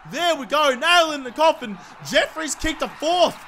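high, excited victory cheer; triumphant goal call. Real, not acted. Gamer hailing his player's fourth goal, triumphant cheer. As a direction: call everyone in to celebrate.

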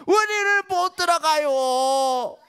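high, slow, crying shout; wailing cry. Acted. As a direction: sob it out in despair. Ten spies wail their refusal. (울부짖듯 천천히)

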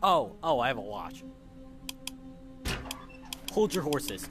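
normal talking voice

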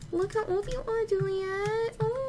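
soft, high-pitched gushing; high, soft squeak of fondness; high cooing. Real, not acted. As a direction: coo it high and small. Cooing at aged-up game character.

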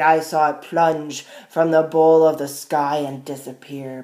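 proud, forceful moose declaring; mighty moose. A narrator's reading. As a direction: boom it out as a big moose. Voices the moose in story.

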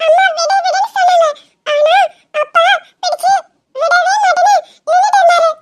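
put-on high little girl's voice telling tales; child voice. Little girl tells grandma her parents were fighting, high child voice. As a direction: play the small daughter tattling.

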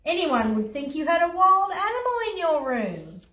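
storybook dad calling out a telling-off; booming dad. A narrator's reading. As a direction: boom it up the stairs like a cross father. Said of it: "Dad's voice boomed up the stairs".

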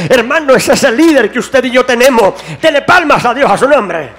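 climbing to shouted, loud preaching; preaching at full force. Acted. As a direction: drive it home like a fiery pastor.

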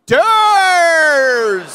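high, long-held call; imitated street shout. Imitating fans shouting to his friend, drawn-out holler. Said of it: "People are like".

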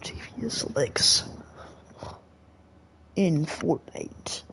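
slow dramatic whisper. Pretend. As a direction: whisper it like a movie trailer.

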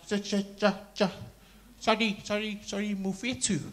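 soft, stammering lady's voice. Acted.

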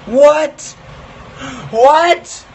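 loud, high, slow shocked yell; shocked shouting. Real, not acted. Shocked at brown toothpaste.

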